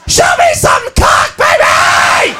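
high, drawn-out scream turning raspy; hammed-up club-girl shriek; raunchy screaming. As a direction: holler wildly at a stripper. Wild girl at strip club.

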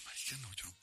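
low, half-whispered self-talk; retold hushed panic; whispered dismay. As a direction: say it under your breath, overwhelmed. Retells choking up before singing.

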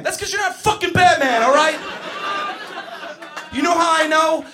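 high, loud teacher rant; exasperated shouting. Acted. Teacher correcting boy who thinks he's Batman, exasperated shouting. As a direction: yell back, fed up with the kid. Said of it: "That's what I wanna say".

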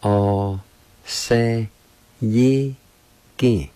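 "All say ye ki" one syllable at a time; teacher spacing out "All say ye ki"; syllable-by-syllable demo. Shown as a demonstration. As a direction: sound out "All say ye ki" with a pause after each part. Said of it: "is pronounced like this".

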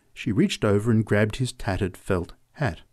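ordinary delivery

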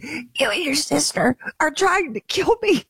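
high, strained, gasping reproach; imitated breathless scolding. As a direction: force the words out as if barely able to breathe. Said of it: "get some energy to form some words".

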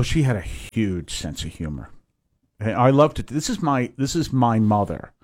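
ordinary talk, nothing marked

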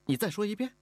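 high, incredulous challenge; disbelieving demand. Acted. Mistress questions the defiant girl, in high-pitched disbelief. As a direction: demand it as if you can't believe your ears. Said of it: "她不相信似的追问了一句".

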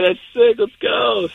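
imitating an excited buddy's cheer; high-pitched congratulations, acted out; retold excited cheer. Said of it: "And he's like".

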